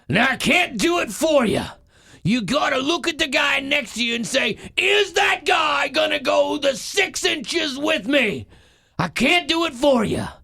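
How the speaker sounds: loud, high coach's pep talk; rally the team like a fired-up coach; Al Pacino impression. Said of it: "It's Al Pacino, Any Given Sunday".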